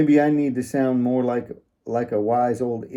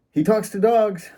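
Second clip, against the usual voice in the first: voicing Wendy; 10 semitones higher, 4 dB louder than usual. Wendy bragging to bartender.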